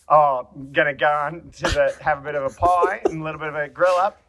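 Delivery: exaggerated, thicker Aussie accent with molasses on it; thick Australian accent. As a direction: lay on a coarse Australian accent. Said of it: "The Australian accent, I think she's a little thicker".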